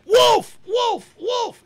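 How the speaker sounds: put-on high, loud, shrill-pitched boy's call; boy crying wolf. Narrator voicing the boy crying wolf, high calling out. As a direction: call out like a bored shepherd boy faking alarm.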